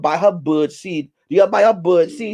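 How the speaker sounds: ordinary delivery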